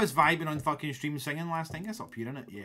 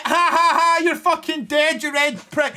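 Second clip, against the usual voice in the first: mocking taunt; 9 semitones higher, 6 dB louder than usual. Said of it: "He's like".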